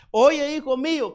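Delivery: llamado de mamá, muy agudo y fuerte; voz de mamá. Acted. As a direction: grita al hijo como una mamá. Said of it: "Porque es la mamá". (la mamá llama al hijo con voz alta y aguda)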